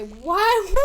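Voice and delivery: mock gamer whine; exaggerated gamer-nerd squeal; whiny gamer voice. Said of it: "weird whiny gamer voices".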